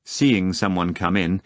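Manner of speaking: plain reading voice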